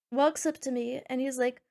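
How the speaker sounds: normal talking voice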